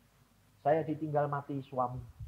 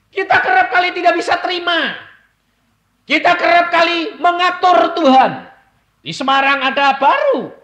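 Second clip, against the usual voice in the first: fiery preaching; 17 dB louder than usual. Acted. Preacher to congregation, loud fiery preaching. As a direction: thunder it from the pulpit.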